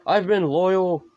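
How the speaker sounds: high, drawn-out imitated plea; mock pleading. Rick pleading with her at first, lightly acted high plea. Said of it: "he tries to plead with her".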